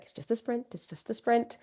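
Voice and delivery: soft, repeated reassurance to herself; quiet self-talk mantra. Retold or imitated. Runner calming herself before forty-six miles, quiet mantra. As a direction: murmur it to shrink the distance. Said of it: "in my mind to reduce it".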